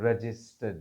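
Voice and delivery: रजिस्टर्ड said softly as red͡ʒɪsʈə, no R; non-rhotic registered. Shown as a demonstration. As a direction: say रजिस्टर्ड quietly without the R. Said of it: "आर नहीं पढ़ते".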